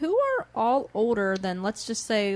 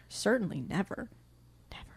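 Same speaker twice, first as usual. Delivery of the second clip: whisper; 9 dB quieter than usual. Real, not acted. Sarcastic aside about friends.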